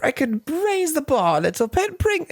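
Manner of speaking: high, far-off snooty musing; condescending professor. Retold or imitated. Higgins appraising Eliza in a high, snooty voice. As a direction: size her up like a beat-up car. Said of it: "looking at her like she's a beat up car".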